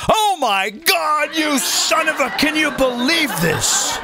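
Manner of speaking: high, drawn-out cry of disbelief; mock outrage. Host mimicking critics when Trump does it once, mock outrage. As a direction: stretch it out in hammed-up indignation.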